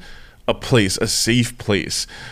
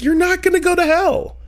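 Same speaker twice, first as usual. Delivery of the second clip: imitating therapist. Therapist voiced in story.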